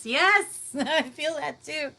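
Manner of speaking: high, delighted burst into laughter; excited yes. Real, not acted. Host thrilled by a viewer's goosebumps, laughing. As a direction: gush with joy at the comment.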